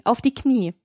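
mildly imperious order; commanding. A narrator's reading. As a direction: give a firm order without shouting. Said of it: "gebot sie". (gebieterisch, ohne zu schreien)

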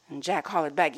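ordinary delivery, nothing marked

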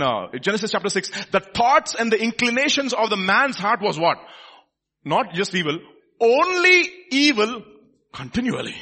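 loud, high-pitched, driving proclamation; full-force preaching. Real, not acted. Preacher tells people genuine prophets will weep, full-force preaching. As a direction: preach it hard, stretching key words.

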